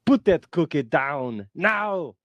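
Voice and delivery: put-on slow Austrian accent, no shout; Arnold Schwarzenegger impression. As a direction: calmer Schwarzenegger repeat. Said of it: "Arnold Schwarzenegger ... when he says".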